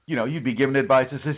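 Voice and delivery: ordinary talk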